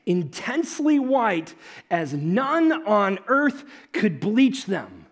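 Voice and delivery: slow, solemn reading; slow, reverent Bible reading; scripture reading. Acted. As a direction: read the verse slowly with reverence. Reading the transfiguration verse.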